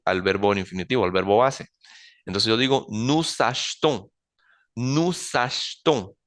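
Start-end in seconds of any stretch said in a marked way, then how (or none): 2.9-6.1 achetons slow, ash-tohn, first e dropped; slowly, nous achetons as noo-zash-tohn; slow French drill. Shown as a demonstration. Teacher slowly models nous achetons without the e. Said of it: "vuelvo a no pronunciar esa E".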